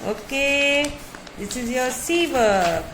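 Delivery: normal talking voice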